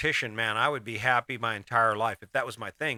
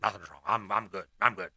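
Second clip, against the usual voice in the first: grumpy denial; 5 semitones lower than usual. Retold or imitated. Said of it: "I'm like".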